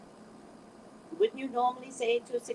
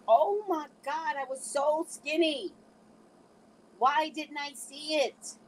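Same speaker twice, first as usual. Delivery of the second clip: high, drawn-out amazed lament; mock dismay; 6 semitones higher, 3 dB louder, slower than usual. Woman looking back at old pictures, exaggerated dismay. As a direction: stretch it out, pitched up, in hammed-up regret.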